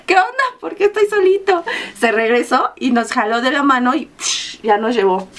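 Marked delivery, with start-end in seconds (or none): 0.0-1.6 imitating Edu. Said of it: "y dijo".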